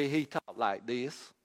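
slow, lightly put-on country Galilean accent. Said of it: "He had an accent".

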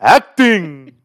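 grand, drawn-out theatrical flourish; loud mock grandeur; hammed-up thespian announcement. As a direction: proclaim it like a pompous actor. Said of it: "is what we call in the business".

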